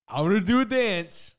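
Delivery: loud playful squeal; hammed-up announcement. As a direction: announce it like a goofy showman.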